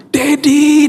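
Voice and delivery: very high, drawn-out squeal; child's excited shout. Retold or imitated. Child spotting the orange sticker, imitated high excited shriek. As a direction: imitate a thrilled kid calling out. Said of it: "dia berteriak ketika dia lihat".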